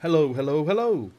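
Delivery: high parrot call, very loud; loud, clear parrot. A narrator's reading. Hidden parrot heard across the hall, calling loud and clear. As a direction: project it like a bird from another room. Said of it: "a voice came loud and clear".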